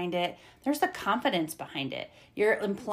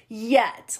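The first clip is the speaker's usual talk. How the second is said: 'yet' loud and higher, with presence; full-volume yet; 4 semitones higher, 8 dB louder than usual. Shown as a demonstration. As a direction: give 'yet' a lot of volume. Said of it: "tone and presence".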